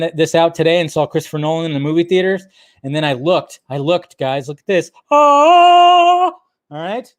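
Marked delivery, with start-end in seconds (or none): 5.1-6.3 loud, high drawn-out "oh!"; hammed-up cry of wonder; amazed gasp. Streamer showing off cinema listings, amazed.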